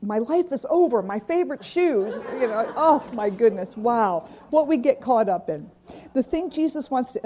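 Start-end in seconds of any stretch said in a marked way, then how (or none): 0.0-4.3 hammed-up little tragedy, a bit louder; mock despair. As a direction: play the lost shoes like the end of the world, half laughing. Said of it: "I thought".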